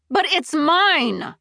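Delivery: high, loud, drawn-out insistence; slow, outraged wail; anguished protest. A narrator's reading. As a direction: claim it as if stamping your foot. Protest while stamping her foot.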